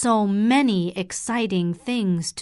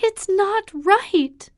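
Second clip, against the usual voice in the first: upset protest; 11 semitones higher, 6 dB louder, slower than usual. Acted. Catherine protesting her father.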